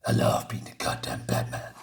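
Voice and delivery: low, voiceless comic-book rasp; hammed-up dark vigilante whisper; lunatic Batman. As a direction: drop into a hushed, crazed growl. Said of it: "laughing like a lunatic".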